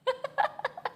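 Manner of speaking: high, staccato laugh; rapid high-pitched laughing; imitated laugh. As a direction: giggle high in quick bursts. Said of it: "laughing and farting at the same time".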